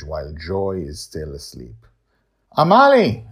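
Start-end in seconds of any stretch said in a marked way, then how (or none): 2.6-3.3 called high and loud across the yard; calling out. Acted. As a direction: reach her from the porch. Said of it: "I call her from the porch".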